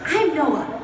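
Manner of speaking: lightly put-on voice of a co-star; brief, half-laughing mock introduction; Noah. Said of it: "can you do an impression of Noah".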